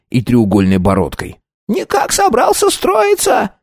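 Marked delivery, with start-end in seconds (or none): 1.7-3.5 very high, thin, piercing voice; squeaky voice. A narrator's reading. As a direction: squeak like a little clerk. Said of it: "От его писклявого голоса". (писклявый голос)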